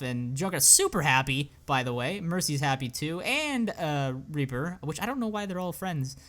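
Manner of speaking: ordinary delivery, nothing marked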